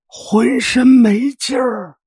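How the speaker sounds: high, slow, breathless pleading; feeble old man. Acted. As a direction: drag out each weak word. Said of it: "老人有气没力地说".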